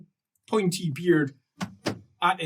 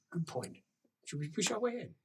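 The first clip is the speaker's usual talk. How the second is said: furtive plotting; 15 dB quieter than usual. Acted. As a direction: propose it on the sly. Guards plot to push in.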